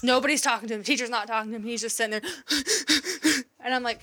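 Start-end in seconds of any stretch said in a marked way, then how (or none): 2.4-3.6 quick panicky gasps, acted out; rapid audible breaths, imitated; imitated hyperventilating. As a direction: mimic a child gasping in distress. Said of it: "he's fucking hyperventilating".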